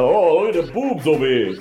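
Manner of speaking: put-on laughing, lightly done New Jersey accent. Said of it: "thick New Jersey accent".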